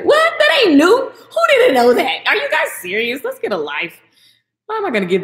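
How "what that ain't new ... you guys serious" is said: high, loud what; shocked disbelief; loud, high and incredulous. Real, not acted. Disbelief at fans' surprise.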